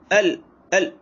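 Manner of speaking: अल jabbed abruptly; jerked lam wrong. Shown as a demonstration. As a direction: demonstrate the jerky mistake on अल. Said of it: "इस तरीके से झटका नहीं देना".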